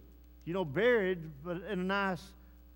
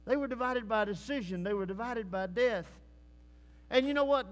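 ordinary talk, nothing marked

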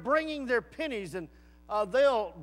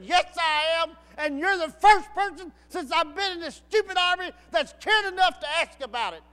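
loud, higher, all-in reply; eager outburst; 8 semitones higher, 7 dB louder than usual. Retold or imitated. Soldier standing up answering the preacher, loud. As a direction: blurt it out, finally heard.

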